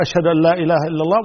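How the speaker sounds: quick recitation back at speaking volume. Shown as a demonstration. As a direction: bring the voice back up, briskly. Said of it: "ثم بعد ذلك يرفع صوته".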